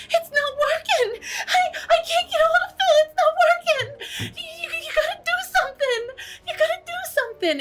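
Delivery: very high, loud frantic squeal; panicked Adela. Acted. Adela begging party as her ring fails, panicked shriek. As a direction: shriek for help in a tiny high voice.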